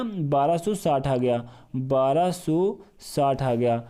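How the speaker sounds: slow and deliberate, each number weighed; slow emphatic answer. Shown as a demonstration. Teacher slowly announcing the LCM to the class. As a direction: let the answer sink in slowly.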